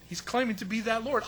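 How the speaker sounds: usual speaking voice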